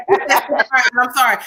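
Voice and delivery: slow, laughing I'm sorry; laughing apology. Real, not acted. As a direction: apologise through real laughter. Laughing at spirit's outburst.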